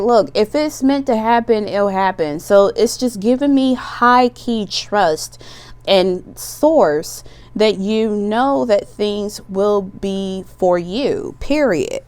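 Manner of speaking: ordinary delivery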